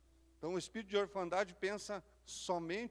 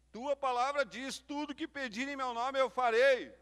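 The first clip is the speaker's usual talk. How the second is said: preaching; 7 semitones higher, 7 dB louder than usual. Acted. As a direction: drive it home to the congregation. Pregação em força total. (pregação fervorosa, mais alta e lenta)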